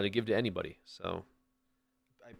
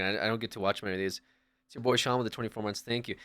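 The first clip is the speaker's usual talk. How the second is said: ordinary talk, nothing marked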